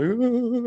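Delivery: high, drawn-out wail falling away; mock crying whimper. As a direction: imitate a kid giving in tearfully. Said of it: "he's crying".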